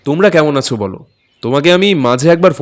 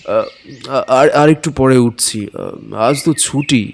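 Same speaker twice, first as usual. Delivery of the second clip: sleepy mumble; slower than usual. Acted. Husband mumbling half asleep.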